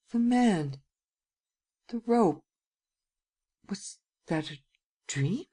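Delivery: faint, halting, anxious questioning; softly fearful, pausing between phrases. A narrator's reading. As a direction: grope anxiously through it, high then hushed. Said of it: "bending forward anxiously".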